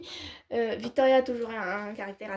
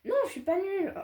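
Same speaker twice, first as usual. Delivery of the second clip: put-on child protest; 9 semitones higher than usual.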